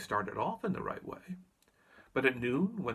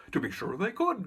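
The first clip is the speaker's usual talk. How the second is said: eager Scarecrow cry; 6 semitones higher, 4 dB louder than usual. A narrator's reading. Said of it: "cried the Scarecrow".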